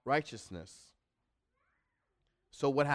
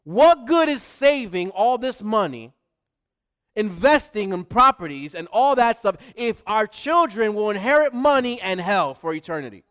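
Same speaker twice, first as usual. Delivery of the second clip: loud, higher, impassioned preaching; urgent, serious warning; 6 semitones higher, 9 dB louder than usual. Real, not acted. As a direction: drive the warning home at full volume. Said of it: "I want y'all to know how serious this is".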